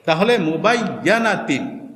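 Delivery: usual speaking voice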